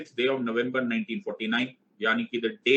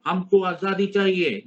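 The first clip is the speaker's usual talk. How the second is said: high, loud, slow slogan; mock protest shout; 7 semitones higher, 5 dB louder, slower than usual. Student insisting on freedom to the teacher, mock protest shout. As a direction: call out a demand like a play-acted protester. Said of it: "चिल्ला के बोलोगे".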